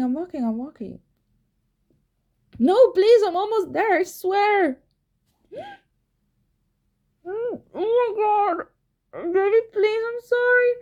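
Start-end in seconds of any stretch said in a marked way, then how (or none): 2.6-10.0 pleading. Acted. Begging under vibrator intensity. 10.1-10.7 high, strained apology; desperate pleading. Acted. Baby, please.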